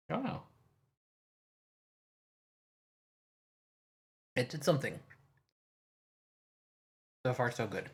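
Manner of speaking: talking while crunching ice; words round a mouthful of ice. Real, not acted. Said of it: "Oh, I'm crunching ice".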